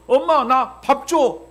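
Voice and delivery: put-on high, loud, slow kid's plea; simple child request. Pastor showing the simple way a child asks mom. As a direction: ask plainly like a hungry child. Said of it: "하면 끝나는 거 아니겠어요".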